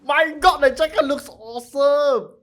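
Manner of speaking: high, loud gushing; thrilled fan, voice way up; awestruck excitement. Real, not acted. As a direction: gush loudly, stretching the last words. Fan admiring character's new look.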